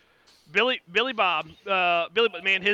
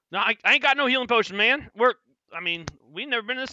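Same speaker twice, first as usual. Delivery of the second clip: higher, loud, fast; flustered hillbilly; 6 semitones higher, 6 dB louder, faster than usual. Acted. NPC answering the party, fast and loud. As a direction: blurt it out quickly.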